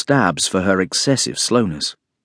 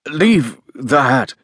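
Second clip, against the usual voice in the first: slow, separated words, higher pitch; very distinct; 4 semitones higher, much slower than usual. A narrator's reading. Guest repeating his order through a cloth, very distinctly. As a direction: spell out the order word by word.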